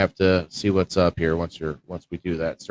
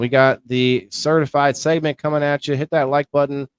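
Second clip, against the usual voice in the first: higher and louder, pumped-up hype voice; hyped-up host; 6 semitones higher, 3 dB louder than usual. Acted. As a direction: hype the viewers like a stream host pushing for likes. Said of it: "Let's get that excitement back up".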